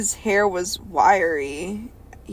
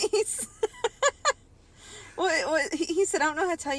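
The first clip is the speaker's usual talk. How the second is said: high, breaking into laughter; laughing; 7 semitones higher, 5 dB quieter than usual. Real, not acted. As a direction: read it through giggles. Laughing while reading.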